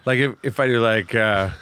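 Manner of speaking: ordinary talk, nothing marked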